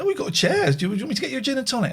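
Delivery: very high-pitched mock mum voice; school mum. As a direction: imitate an over-helpful mum, pitched right up. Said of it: "one of the mums said".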